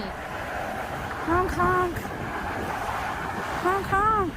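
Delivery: high sing-song honk honk. Acted. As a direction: call it out like a truck horn. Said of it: "I do the, do the honk honk".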